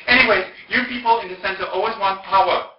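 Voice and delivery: high, loud mock accusation; indignant. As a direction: imitate her accusing the whole center. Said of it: "then she became very indignant".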